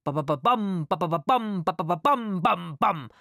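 rhythmic "ba-ba-ba-bam" with loud final beats; vocal drumming. A narrator's reading. Girl stamping along like a troop on the move, vocal drumming. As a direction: drum out a marching beat with the voice. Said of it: "trommelte sie und stampfte dazu".

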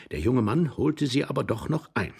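ordinary delivery

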